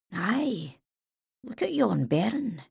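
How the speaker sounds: put-on soft, slow Scottish old man; a tender, slow Scottish murmur; Scottish accent. A narrator's reading. Old soldier Robbie speaks softly.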